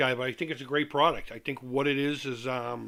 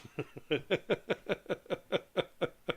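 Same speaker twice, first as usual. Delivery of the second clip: soft amused laugh; 4 semitones higher, 5 dB quieter than usual. Real, not acted. Laughs at own joke.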